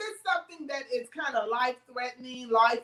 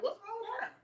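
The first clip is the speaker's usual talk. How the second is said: bewildered question; 9 semitones higher, 10 dB quieter, faster than usual. Pretend. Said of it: "that person is like".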